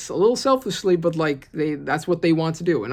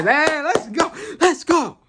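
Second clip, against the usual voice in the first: much higher, louder hype; excited cheer; 13 semitones higher, 5 dB louder, slower than usual. Real, not acted. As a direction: cheer like a pumped-up fan. Hyped reacting to the crew.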